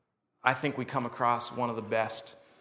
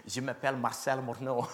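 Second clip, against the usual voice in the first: mock French-Canadian accent, lightly done; imitating a cool French accent. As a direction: say your name like a suave Quebecois. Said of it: "they say my name so cool".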